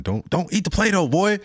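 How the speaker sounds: high mock scold of a kindergarten teacher; teacher scolding a kid.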